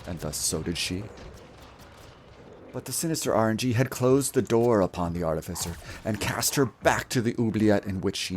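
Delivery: grand, measured fairy-tale narrator; epic story narration. Acted. Streamer narrating his game run as legend. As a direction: tell it like an ancient legend.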